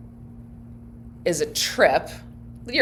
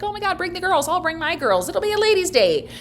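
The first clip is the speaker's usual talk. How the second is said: much higher, excited friend voice; gushing friend; 11 semitones higher, 3 dB louder, faster than usual. Retold or imitated. Imagined friend inviting the daughters, much higher and excited. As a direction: squeal it like a thrilled mom. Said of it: "Maybe it's like".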